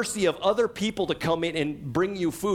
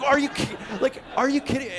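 incredulous comedian; 10 semitones higher than usual. Retold or imitated. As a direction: ham up the comic's outrage. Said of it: "he's like".

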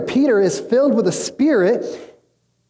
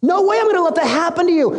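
high-pitched mock protest; acted protest; 9 semitones higher than usual. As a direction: play a disciple objecting, pitch right up. Peter protests to Jesus in retelling.

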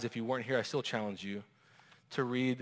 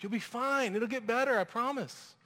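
much higher, sing-song false comfort; mock empty reassurance; 11 semitones higher than usual. Friend offering an escape instead of listening, mock cheerful. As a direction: brush off their pain with cheap cheer.

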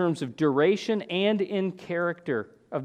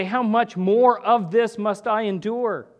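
higher and louder, an exasperated plea; anguished lament to the Lord; frustrated cry; 6 semitones higher, 4 dB louder, slower than usual. Retold or imitated. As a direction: cry out to God, worn out and fed up. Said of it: "you just get frustrated. And you say".

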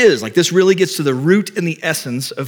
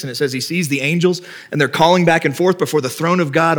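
ordinary talk

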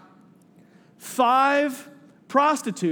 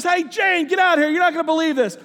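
higher-pitched call to someone in back; 7 semitones higher, 4 dB louder than usual. Retold or imitated. Cook calling his wife out from the back, excited holler. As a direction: holler for someone to come and see.

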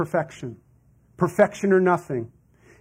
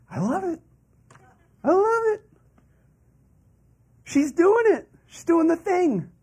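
much higher, thrilled and beaming; delighted God; 12 semitones higher than usual. Acted. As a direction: cheer her on like a proud parent. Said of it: "God looks down and goes".

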